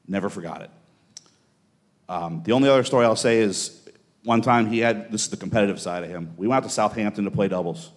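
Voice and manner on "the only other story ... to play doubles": ordinary talk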